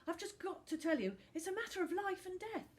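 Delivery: put-on high, loud begging boy. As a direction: beg desperately. Finn begs urgently.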